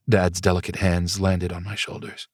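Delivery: plain reading voice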